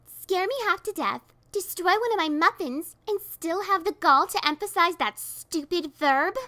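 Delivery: high, loud angry outburst; building rage; high loud furious rant. A narrator's reading. Furious sister shouts at visitor.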